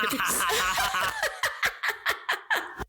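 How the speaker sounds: bright laugh after the joke. Real, not acted. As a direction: burst out laughing.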